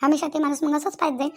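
usual speaking voice

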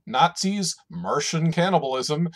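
put-on booming old-time radio announcer. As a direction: announce it big over the music. Podcast intro announcer read.

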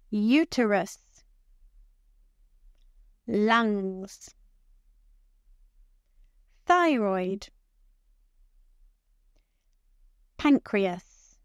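clear, deliberate teacher pronunciation with long pauses to repeat; careful model words. Shown as a demonstration. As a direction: say each organ name slowly for learners to copy. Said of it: "Listen and repeat".

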